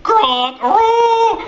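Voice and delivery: loud honking bellow on aroo; moose honk. Acted. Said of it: "this is not practice for gronk and aroo".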